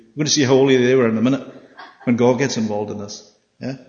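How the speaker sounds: ordinary delivery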